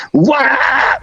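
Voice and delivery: quick imitated rock shriek; mock metal yell. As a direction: belt it like the singer. Imitating Disturbed song.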